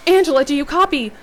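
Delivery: high, loud call into the radio; panicked radio call; scream. A narrator's reading. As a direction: shout it into the walkie-talkie. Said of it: "scream into it".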